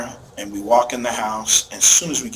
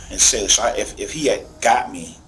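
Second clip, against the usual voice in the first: usual speaking voice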